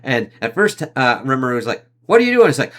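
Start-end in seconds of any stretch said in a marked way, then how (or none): 2.1-2.5 shocked. Retold or imitated. Rimuru shocked by the attack.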